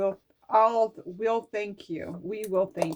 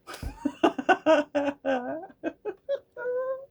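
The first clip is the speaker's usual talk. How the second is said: laughing through tears, high and breaking; a real half-laugh, half-cry; tearful laugh; 9 semitones higher, 3 dB louder than usual. Real, not acted. Moved to tears while reading her notes, laughing and crying.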